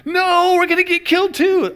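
high, loud mock panic; freaking out. People in Moses' house fearing death, high mock panic. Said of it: "are freaking out".

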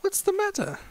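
high-pitched British character voice; British accent. Acted. Carpenter leads the newcomer to the farm, high British accent. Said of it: "I don't know why I'm British".

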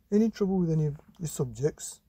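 quick father's question; dad rattling it off; dad's voice. Acted. Father asking son about subjects, quick. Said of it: "said Dad".

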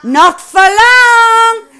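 loud, high, very slow taunt; drawn-out taunt. Real, not acted. Taunting the enemy player, drawn-out taunt. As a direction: stretch it out to mock the enemy.

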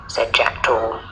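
plain reading voice

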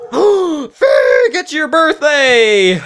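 high, drawn-out delighted shout; gasp, then cry it out slowly and high; thrilled high-pitched birthday announcement; excited realisation. Real, not acted. Suddenly realising child's birthday.

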